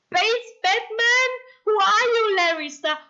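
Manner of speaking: high, loud burst of disbelief; indignant yell. Real, not acted. Streamer challenging a viewer over Batman, yelling. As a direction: yell at them in mock outrage. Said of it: "yelled at you".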